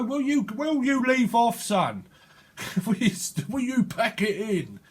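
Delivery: high-pitched mock telling-off; playfully acted parental exasperation; exasperated parent. Parent breaking up squabbling children.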